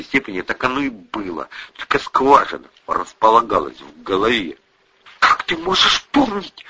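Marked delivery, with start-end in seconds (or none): 5.2-6.6 high, indignant mother; flaring up. A narrator's reading. Mother to daughter over an awkward memory, flaring up. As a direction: flare up, pitch jumping high. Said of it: "вспыхнула мать".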